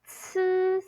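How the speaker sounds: "c" held high as a long "tsss"; drawn-out ts sound. Shown as a demonstration. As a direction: sound out "c" as "ts", stretched. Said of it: "c sound".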